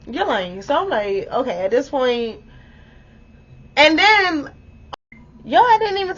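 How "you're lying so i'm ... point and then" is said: ordinary delivery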